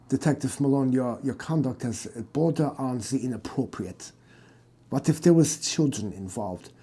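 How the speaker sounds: a lightly voiced German aristocrat; German accent. German doctor explaining his family, in a German accent. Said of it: "Your stupid accent".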